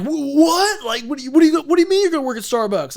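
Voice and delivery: high, loud, stuttering incredulity; mock freak-out. Shipmates learning of the Starbucks plan, hammed-up disbelief. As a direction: sputter like an astonished colleague. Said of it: "like, freaking out".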